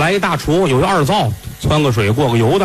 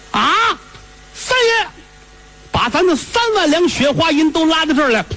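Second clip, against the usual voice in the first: squeaky high servant voice, pushing the idea; high eager servant. Acted. Servant eagerly urging his master, high and excited. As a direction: pitch it way up like an excited lackey.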